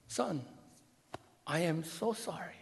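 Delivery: soft, very slow, heartfelt; slow it right down and mean every word; tender apology. Retold or imitated. Father apologizing to his scared son, soft and slow.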